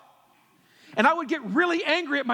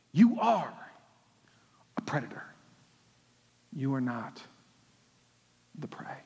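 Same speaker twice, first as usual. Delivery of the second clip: hushed; 11 semitones lower, 5 dB quieter than usual. Real, not acted. Preacher drops to hushed intensity.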